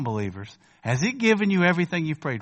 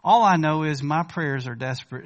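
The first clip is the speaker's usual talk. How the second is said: normal talking voice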